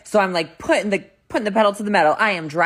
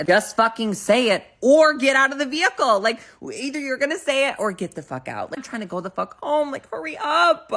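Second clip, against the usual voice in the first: high-pitched impatient outburst; hammed-up frustrated rant; exasperated demand; 8 semitones higher, 4 dB louder than usual. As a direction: beg them to get on with it, fed up. Acted impatience at stalling date.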